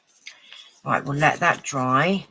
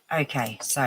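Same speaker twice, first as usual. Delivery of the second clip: usual speaking voice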